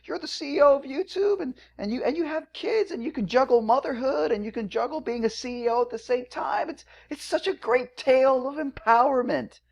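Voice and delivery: high gushing sarcastic praise; mocking fawning voice. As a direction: gush over her like a fawning fan. Mocking how media coddles CEOs.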